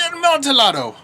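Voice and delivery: high excited exclamation of the wine's name; excited cry. Acted. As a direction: say the name like a greedy thrill. Fortunato excited by the wine.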